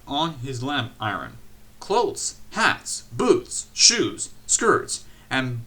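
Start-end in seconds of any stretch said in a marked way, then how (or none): none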